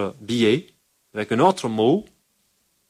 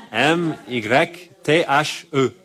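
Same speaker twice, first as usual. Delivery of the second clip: "M Y T H E" letter by letter, slowly and a little higher; spelled out slowly; 4 semitones higher, much slower than usual. Shown as a demonstration. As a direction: spell it out one letter at a time. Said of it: "ma prononciation". (« M Y T H E » épelé lettre par lettre, lentement)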